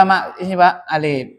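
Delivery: ordinary delivery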